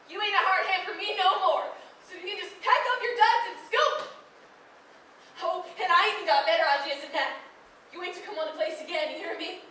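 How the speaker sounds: rising fury, ending in a loud shout; furious farm girl ordering the hired hand off her land; angry dismissal. Acted. Laurie fires Judd angrily.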